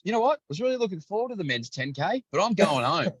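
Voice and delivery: put-on high, grumbling old bloke; an old spectator grumbling about the noisy commentators, in a high mock voice; older gentleman. Older gentleman gives up and leaves.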